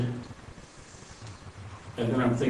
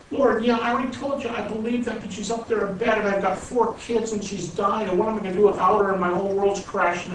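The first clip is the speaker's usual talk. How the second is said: higher, anguished pleading; begging for an answer, voice pitched up; desperate plea; 8 semitones higher than usual. Retold or imitated. Gary begs the Lord about his dying wife, higher anguished plea.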